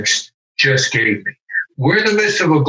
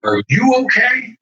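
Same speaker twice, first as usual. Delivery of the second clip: "Are you okay?" higher, rising on "you"; caring question; 9 semitones higher than usual. Shown as a demonstration. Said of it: "just like that".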